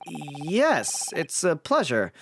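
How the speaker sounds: high, hesitant lawyer voice; nervous young lawyer. Acted. Defense lawyer introducing himself to the governor, nervous and hesitant.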